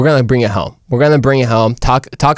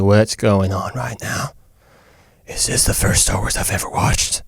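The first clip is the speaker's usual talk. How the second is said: hushed bewilderment; 6 semitones lower, 6 dB quieter than usual. Acted. Recalling a confusing first viewing of the movie, hushed bewilderment. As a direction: mutter it under your breath, lost.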